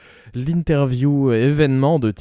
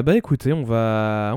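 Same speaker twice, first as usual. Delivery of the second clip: ordinary delivery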